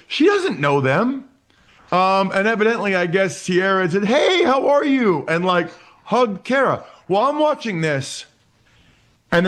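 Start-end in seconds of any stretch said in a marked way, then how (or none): 4.0-5.2 high, drawn-out imitated hello; mock friendly greeting. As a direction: greet someone like an old friend, pitched high and slow. Acting a friendly greeting at wedding.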